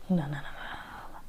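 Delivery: breathy whispered no, no, no. Real, not acted. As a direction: whisper it, shaking it off. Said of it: "whispered refusal".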